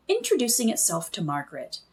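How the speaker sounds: plain reading voice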